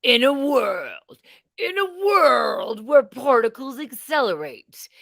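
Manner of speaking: epic voiceover announcer; movie trailer voice. Pretend. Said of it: "I was kinda hoping for".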